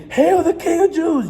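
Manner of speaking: high, slow, hammed-up terrified praise; mock-terrified hail. Enemies forced to bow, in high hammed-up terrified praise. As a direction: cower as if you finally mean it. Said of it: "you're gonna be scared as hell".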